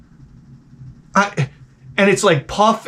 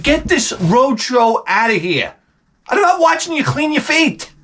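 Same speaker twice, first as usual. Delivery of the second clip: mock yell climbing high in pitch; retold yelling; 7 semitones higher than usual. Disgusted son-in-law ordering her out, mock yelling. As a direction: start slow and emphatic, then go high in outrage. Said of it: "no reaction to my yelling".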